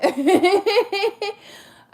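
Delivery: loud, high burst of laughter; big laugh. Real, not acted. Admitting she cries a lot, big self-amused laugh.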